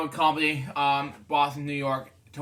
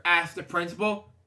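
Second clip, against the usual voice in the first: ordinary delivery, nothing marked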